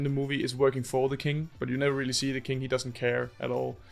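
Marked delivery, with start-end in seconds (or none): none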